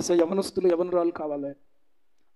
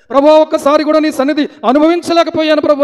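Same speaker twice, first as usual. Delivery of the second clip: loud, high imitated wailing; imitated tearful plea; weeping prayer; 7 semitones higher, 11 dB louder than usual. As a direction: lament to the Lord. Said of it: "అని ఏడుపు".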